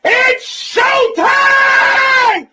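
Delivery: loud high hype shout; drawn-out ringside yell. Real, not acted. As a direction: announce the fight like a ring MC. Hyping the fight.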